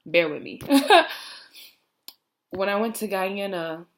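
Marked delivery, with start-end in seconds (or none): 0.6-1.6 a short high laugh through tears; embarrassed teary laugh. Real, not acted. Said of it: "I didn't think I was gonna get this emotional".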